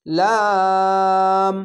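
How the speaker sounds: লাম with the a held very long and steady; stretched three alif. Shown as a demonstration. Teacher demonstrating the long three-alif vowel to students. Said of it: "এখানেও তিন আলিফ টেনে পড়বেন।".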